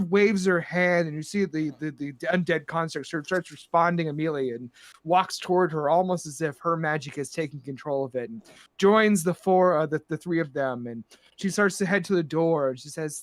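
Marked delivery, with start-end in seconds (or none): none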